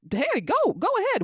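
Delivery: mock carefree go ahead; sarcastic go ahead. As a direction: toss it off like you don't care. Sarcastic dismissal.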